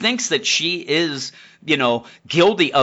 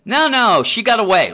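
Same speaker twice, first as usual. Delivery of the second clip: high, louder flustered cop voice; cop excuse; 9 semitones higher, 5 dB louder than usual. Retold or imitated. Cops fumbling excuses to the boss, high and louder. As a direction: squeak out a nervous excuse.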